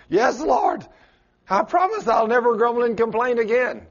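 high pleading vow; earnest promise. Acted. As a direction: swear it earnestly, begging. Repentant promise to God.

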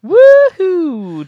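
high, loud drawn-out whoop; excited cheer. Real, not acted. Cheering as the show wraps up, loud whoop. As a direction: stretch the whoop out.

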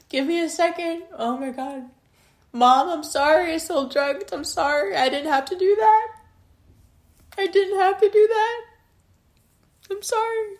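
very high, tearful squeak; real sobbing apology. Real, not acted. Apologising to mom for selling drugs, crying hard. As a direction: cry it out, voice climbing high.

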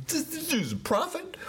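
high, soft, mocking disbelief; a sneering gasp that this man is a prophet; incredulous disbelief. Preacher imagining the listener's scoffing thought, high incredulous. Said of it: "You're thinking".

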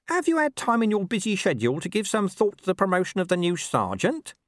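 high-pitched, deferential underling; hopeful corporal. A narrator's reading. Corporal asking the captain about promotion, hopeful. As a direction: sound out the boss in an eager high voice.

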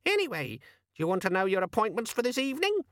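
high gadget-secretary squeak; huffy squeaky demon. A narrator's reading. Demon offering Vimes his appointments, high squeaky voice. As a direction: squeak it officiously.

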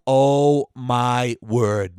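drawn-out, marveling oh my word; slowly savoring the discovery; slow awed delight. Retold or imitated. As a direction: stretch it out in hushed wonder. Sees lamb chops on menu.

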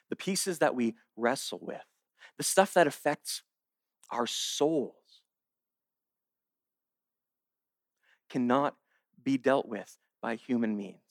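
low, soft, with long pauses; hushed preaching. Real, not acted. Preacher on matters of the soul, hushed and solemn. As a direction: drop right down and let each phrase sit.

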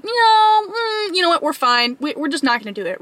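high whiny 'no' sliding down into excuses; mock whine that settles into coaxing; baby voice. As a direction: imitate a whimpering little inner voice. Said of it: "the baby freaking voice".

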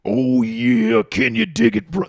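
high, loud gravelly wrestler promo; Macho Man. Retold or imitated. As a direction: growl it out like a hyped-up wrestling promo. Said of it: "I love Macho Man".

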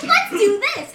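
rally the party like a boss; pumped rallying cry. Retold or imitated. Player imitating herself rallying the paid party, hyped cry. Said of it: "So I'm just like".

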